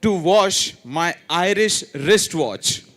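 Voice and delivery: slow teacher model "to wash my Irish wristwatch"; slow model reading. Shown as a demonstration. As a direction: read it out slowly for the class. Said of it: "So I'll repeat it again".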